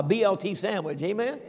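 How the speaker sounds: usual speaking voice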